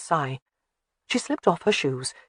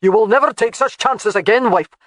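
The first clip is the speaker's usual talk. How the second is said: louder, higher angry shout; 5 semitones higher, 6 dB louder than usual. A narrator's reading. Husband shouts at wife after her risky stunt, shouting. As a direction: roar it at her. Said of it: "His shout nearly ripped".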